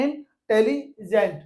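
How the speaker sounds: Intelligent said slowly with pauses between syllables. Shown as a demonstration. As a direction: break intelligent into slow separate syllables. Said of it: "हम pause लेना पड़ता है".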